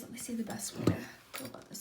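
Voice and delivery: trailing unvoiced whisper to herself. Real, not acted. Tarot reader thinking aloud to herself, whispered. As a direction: half-whisper the thought as it fades.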